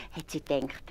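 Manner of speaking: plain reading voice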